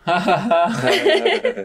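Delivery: slow, drawn-out mocking laugh; teasing laugh. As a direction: laugh at the latecomer. Teasing latecomer about party time.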